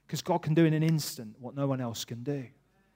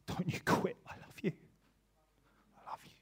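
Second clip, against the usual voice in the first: hushed tender message; 7 dB quieter, faster than usual. Retold or imitated. Retold tender message from God.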